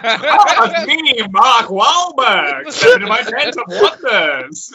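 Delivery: high, loud showman voice, slow and grand; old-time announcer introducing himself under the big top; vaudeville announcer. Retold or imitated. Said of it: "vaudeville announcer".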